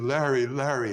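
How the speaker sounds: grieving father calling Larry across the room. Retold or imitated. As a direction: call him over in distress. Father calling out his name.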